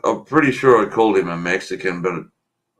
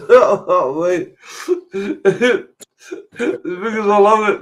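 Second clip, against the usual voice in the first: high-pitched, words breaking through laughter; laughing hard; 11 semitones higher, slower than usual. Real, not acted. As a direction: talk while crying with laughter. Still recovering from laughing fit.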